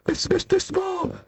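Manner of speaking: small and polite from down below; tiny voice. Acted. Tiny Mister Small introduces himself, high and soft.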